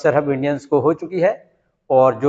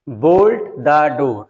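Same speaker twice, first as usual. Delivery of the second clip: pare your nails, stretched out word by word; very slow dictation; 2 semitones lower, much slower than usual. Shown as a demonstration. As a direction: spell it out slowly for the class to write. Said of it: "teacher reads sentence slowly". (बहुत धीरे-धीरे बोलकर लिखवाना)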